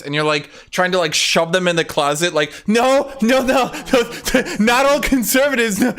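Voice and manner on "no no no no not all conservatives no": mock panicked denial.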